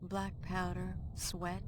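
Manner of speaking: hushed storyteller recalling the past; reflective narration. A narrator's reading. Older Amelia narrates the aftermath.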